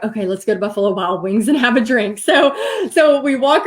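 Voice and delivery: chuckling while quoting her; laughing through it. Retold or imitated. Retelling mother-in-law's drink suggestion, laughing. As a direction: let a laugh break into the words.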